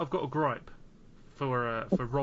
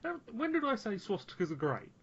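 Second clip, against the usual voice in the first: indignant surprise; 8 semitones higher than usual. Real, not acted. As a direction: squeak out a baffled denial. Protests accusation.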